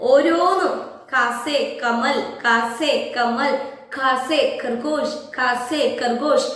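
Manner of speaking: put-on slow, careful recitation for children to repeat; slow drill. Shown as a demonstration. Teacher models a slow drill for students at home. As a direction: spell each word out slowly so kids can copy it. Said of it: "വീട്ടിലിരുന്നു കൊണ്ട് നിങ്ങൾ ഇത്".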